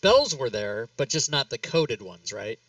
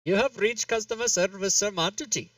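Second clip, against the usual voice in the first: mock hotline greeting; 8 semitones higher than usual. Mock phone-line recorded greeting.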